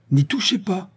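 sharp high furious cry; a mother's fierce warning at the kidnapper; violent command. A narrator's reading. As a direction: snap it out high and hard. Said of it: "reprit Véronique avec violence".

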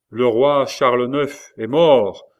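loud, slow herald's announcement; proclamation cry. A narrator's reading. Captain announces the king's death from the balcony, crying out. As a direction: proclaim it to the whole courtyard. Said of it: "cria-t-il trois fois".

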